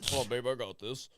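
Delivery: lightly voiced cocky strut; macho swagger. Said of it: "you should, like, walk like this".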